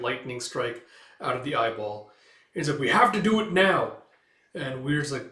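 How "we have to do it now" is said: urgent shout. Acted. Urgent call before the jump.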